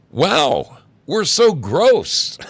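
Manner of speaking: high, loud, drawn-out gushing; enthusiastic. A narrator's reading. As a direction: blurt it out with huge enthusiasm. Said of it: "an enthusiastic".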